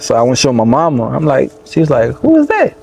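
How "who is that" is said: much higher, startled mother; imitating mama's shocked high question; mama's surprised question. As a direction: squeak it out like a surprised mom. Mama reacting to the name.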